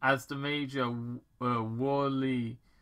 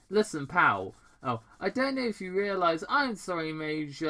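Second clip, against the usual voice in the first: Robotnik; 7 semitones higher, 4 dB louder than usual. Acted. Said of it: "Doctor Robotnik apologised".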